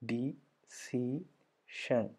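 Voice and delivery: ഡി, സി, ഷൻ as three separate beats; syllable by syllable. Shown as a demonstration. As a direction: pause between dee, see and shan. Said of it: "മൂന്നെണ്ണം വന്നു".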